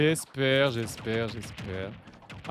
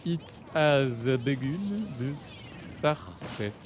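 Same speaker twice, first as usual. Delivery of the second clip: slow, careful English accent on the title; deliberate English accent with pauses between words; self-mocking English accent; much slower than usual. Shown as a demonstration. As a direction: show off an "incredible" English accent, word by word. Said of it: "vu que j'ai un accent anglais incroyable".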